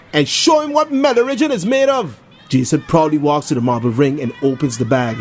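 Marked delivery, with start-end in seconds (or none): 0.1-2.2 crowd shout. Acted. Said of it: "someone in the crowd shouts".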